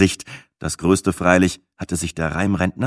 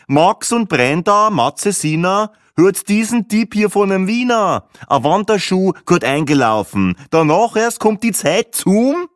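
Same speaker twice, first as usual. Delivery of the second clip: high, loud sing-song poem recital; Viennese rhymester reciting; 9 semitones higher, 4 dB louder than usual. Acted. Rhyming pensioner presents his travel diary to the table, reciting grandly.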